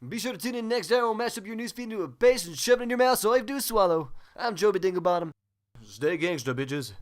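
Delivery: usual speaking voice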